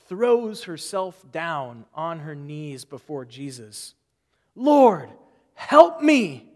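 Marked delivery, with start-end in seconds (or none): 4.5-6.5 loud, high, desperate cry of Lord, help me; desperate plea. Retold or imitated. Woman begging Jesus on her knees, desperate loud cry. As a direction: beg like it is your last hope. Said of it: "It's desperate".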